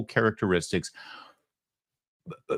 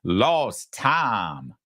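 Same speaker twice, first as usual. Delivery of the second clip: slow Southern "tahm" for "time"; mock Southern accent. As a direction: imitate her drawn-out Southern "time". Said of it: "'Cause that's South Montreal".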